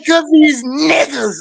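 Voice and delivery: high, drawn-out imitation of his emphasis; with conviction. Retelling how the man said it, with exaggerated conviction.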